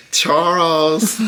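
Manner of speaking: high, loud call to Charles; yelling across the room. Real, not acted. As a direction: shout his name through the house. Calling husband across the house.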